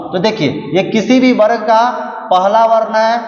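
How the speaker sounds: ordinary talk, nothing marked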